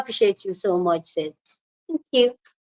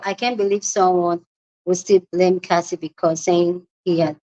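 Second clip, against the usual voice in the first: usual speaking voice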